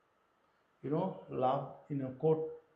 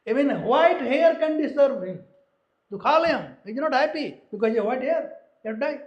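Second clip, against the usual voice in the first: higher, louder, half mocking; loud preaching; 7 semitones higher, 6 dB louder than usual. Real, not acted. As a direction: drive the joke home loudly. Preacher mocks vanity loudly.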